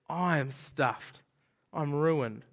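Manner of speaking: slow, low, sinking admission; utter defeat. Acted. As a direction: spell out the prophet's doom word by word. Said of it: "And his reaction is".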